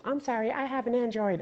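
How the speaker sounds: high, softly apologetic helper voice; imitated photo lady. Lady fumbling with a fan's phone, imitated apology. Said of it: "She goes".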